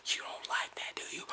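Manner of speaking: harsh voiceless whisper. Real, not acted. Ranting to himself on camera, whispering. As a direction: whisper it all with sharp emphasis. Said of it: "Why am I whispering?".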